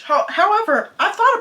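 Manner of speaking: high and loud however; emphatic however. Real, not acted. Vlogger pivots on language plans, high and loud. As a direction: cut yourself off, then hit it hard.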